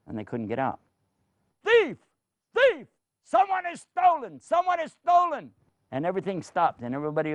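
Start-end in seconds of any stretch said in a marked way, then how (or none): 1.6-5.5 high, loud shouts of thief; alarm shout. Acted. Guards calling out the theft at the gate, loud and high. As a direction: raise the alarm across the palace.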